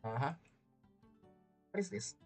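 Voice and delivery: ordinary talk, nothing marked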